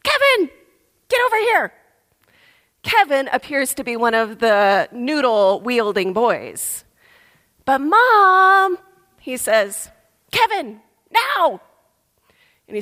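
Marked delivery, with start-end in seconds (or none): 0.0-1.7 high, sharp call across the pool; a mom calling her misbehaving son; mother shouting. Retold or imitated. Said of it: "a lady shouts". 7.6-8.8 put-on whiny boy. Boy protests to mother. 10.3-11.6 stern mother. Retold or imitated. Mother orders son out.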